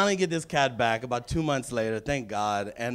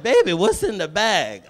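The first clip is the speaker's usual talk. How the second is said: security lady; 6 semitones higher, 9 dB louder than usual. Retold or imitated. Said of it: "She said".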